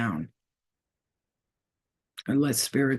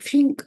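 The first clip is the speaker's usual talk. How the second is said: flicking sound; 10 semitones higher, 4 dB louder than usual. Retold or imitated. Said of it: "They just be like".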